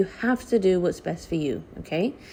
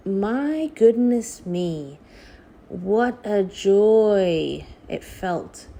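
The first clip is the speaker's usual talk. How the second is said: relief; much slower than usual. Real, not acted. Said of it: "epidural relief".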